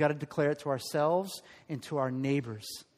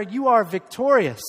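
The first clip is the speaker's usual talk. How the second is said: louder, proclaimed to a neighbor; preacher leads the church telling neighbors, loud; loud declaration; 3 semitones higher, 10 dB louder than usual. Shown as a demonstration. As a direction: call it out boldly to the person beside you. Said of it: "Tell him or her".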